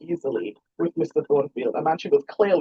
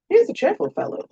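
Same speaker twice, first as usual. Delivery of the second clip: Mister Mason's voice. A narrator's reading. Father voiced higher.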